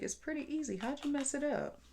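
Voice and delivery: incredulous. Retold or imitated. Puzzled at sister.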